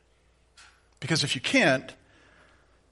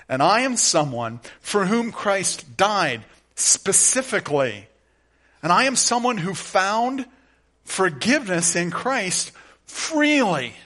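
higher, louder rising preacher's cadence; preaching at full force; 5 semitones higher, 6 dB louder than usual. Acted. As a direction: build to a fervent preaching climax. Preaching climax on the cross.

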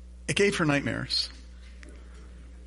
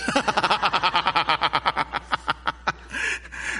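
laughing; 3 semitones higher than usual. Real, not acted. Laughing after daughter's reply.

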